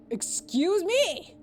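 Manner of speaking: high, loud, drawn-out disbelief; affronted. Acted. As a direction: swoop up in offended protest. Affronted in acted quarrel.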